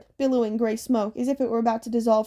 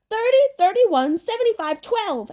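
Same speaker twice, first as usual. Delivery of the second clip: high-pitched yell in a character voice; 11 semitones higher, 5 dB louder than usual. A narrator's reading. Anger reeling off numbers to the passengers, yelled. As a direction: call the numbers out high, starting loud. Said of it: "Anger yelled".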